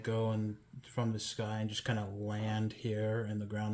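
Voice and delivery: usual speaking voice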